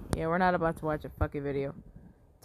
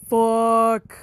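frustrated shout; 5 semitones higher, 12 dB louder than usual. Real, not acted. Loss in game.

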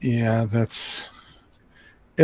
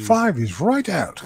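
slow, higher-pitched comic pronouncement; mock scripture quote; 7 semitones higher, slower than usual. Joining in a film quote about numbers, mock scripture. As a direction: draw it out with pitch swooping up.

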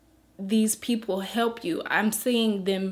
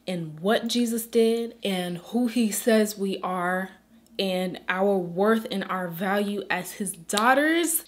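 usual speaking voice

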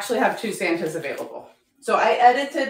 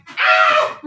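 high, loud shriek of glee; real gleeful shriek, very high and loud; excited squeal; 6 dB louder than usual. Real, not acted. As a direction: shriek with delight as you dash off. Squeals running off to grab Santas.

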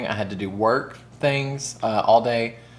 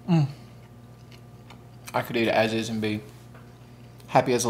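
talking while chewing; muffled chewing speech; mouth full of rice; 5 dB quieter, faster than usual. Real, not acted. Seen 2: Takes forkful of rice at 4.2, then chews while speaking.

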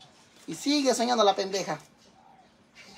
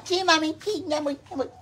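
high, babyish mock reply; lazy son's reply; 8 semitones higher than usual. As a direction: answer like a spoiled boy. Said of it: "Allá va".

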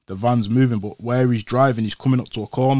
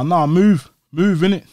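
put-on loud, higher, waving his boys off; raised voice; 5 semitones higher, 5 dB louder than usual. Telling his friends to leave him to it, loud. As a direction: push them away, louder and higher.

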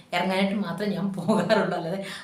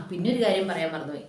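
ordinary talk, nothing marked

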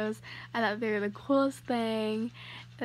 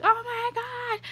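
high, louder squeal of delight; excited squeal; 12 semitones higher, 5 dB louder, slower than usual. Retold or imitated. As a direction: squeal over the gift. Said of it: "I was like".

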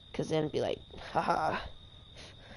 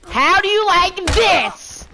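much higher, louder, slow gloating shout; drawn-out taunting yell; 11 semitones higher, 9 dB louder, much slower than usual. Real, not acted. Gamer gloating over a kill, slow loud crowing yell. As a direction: stretch it out and crow it even harder.

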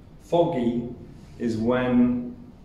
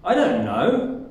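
annoyed; 3 semitones higher, 4 dB louder than usual. Shown as a demonstration. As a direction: sound fed up, lifting KNOW sharply. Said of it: "This shows someone is annoyed".

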